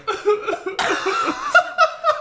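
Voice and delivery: squealing laugh up high; high cackle of laughter; high laughing wheeze. Real, not acted. Laughing about crying.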